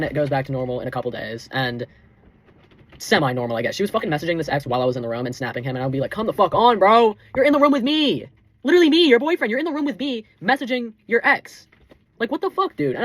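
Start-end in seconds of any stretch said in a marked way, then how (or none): 6.1-13.0 retold angry yell. Retold yelling at girlfriend on phone.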